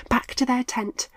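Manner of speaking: plain reading voice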